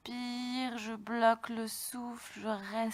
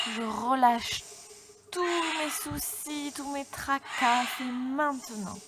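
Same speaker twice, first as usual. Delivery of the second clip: forceful exhale; 6 semitones higher, 4 dB louder than usual. Acted. Releasing breath with force.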